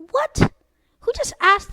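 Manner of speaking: high, loud disbelieving what; shocked what. Real, not acted. Reacting to Ken's creepy question, a shocked loud what. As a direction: yelp it in pure disbelief.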